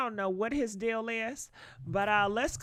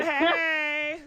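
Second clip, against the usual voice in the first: high, loud, long "hey"; playful girlfriend greeting; 5 semitones higher, 5 dB louder than usual. Pretend. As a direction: sing out a mock greeting to your girl. Acts out greeting a close friend.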